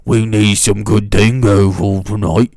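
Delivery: Australian twang, loud and showy; mock Australian. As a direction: play a theatrical Aussie. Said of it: "that's what Australians sound like".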